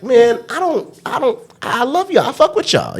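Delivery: very high-pitched mock pleading; high, strained protest. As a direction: squeak out an appeal to be understood. Pleading with those he offended.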